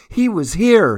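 put-on high, thrilled child's cry; excited exclaim. A narrator's reading. Cassie finding the presents, high excited cry. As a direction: burst out with delight. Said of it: "Cassie exclaimed".

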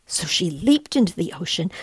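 ordinary delivery, nothing marked

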